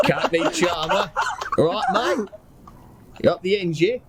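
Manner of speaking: high, badly faked Cockney through laughter; Cockney accent. Player losing grip on Cockney accent, high and laughing. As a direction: overdo the Cockney while cracking up. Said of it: "do your best Cockney accent".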